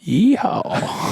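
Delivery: high twangy yeehaw; cowboy yeehaw. Pretend. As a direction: holler like a cowboy. Mock cowboy cheer.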